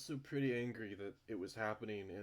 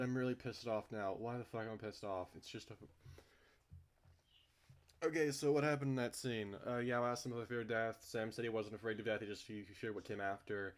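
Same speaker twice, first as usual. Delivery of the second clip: usual speaking voice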